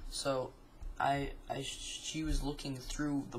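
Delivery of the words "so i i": ordinary delivery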